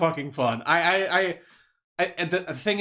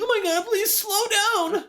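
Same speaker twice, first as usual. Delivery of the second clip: very high, drawn-out mock plea; imitated frightened passenger; slower than usual. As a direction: whine in mock terror for mercy. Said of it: "you'd probably be like".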